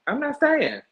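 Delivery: high-pitched emphatic I'm not staying; emphatic repeat. Shown as a demonstration. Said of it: "repeats it with more force".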